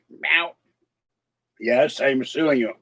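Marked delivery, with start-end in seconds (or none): 0.1-0.5 a short playful meow that rises at the end; mock meow. Owner voicing his cat being sued, with a playful rising meow. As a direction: answer for the cat with a cute little meow.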